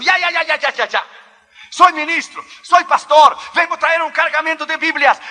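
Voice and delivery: high, loud outburst of confession; panicked shouting. Acted. Pastor confessing to the Russian guard, panicked shouting. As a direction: blurt it all out at full voice, panicking. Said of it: "Tienes que gritar para que toda la Plaza Roja te pueda oír".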